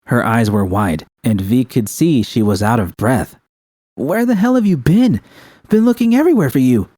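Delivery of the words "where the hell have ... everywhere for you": high, fast, breathless call; shouting. A narrator's reading. Panam shouting at V after searching everywhere. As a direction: Panam yells across the camp. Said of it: "Panam shouted".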